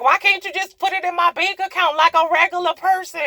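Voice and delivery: a put-upon mock gripe; exasperated complaint. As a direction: complain like you're fed up. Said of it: "I'm like".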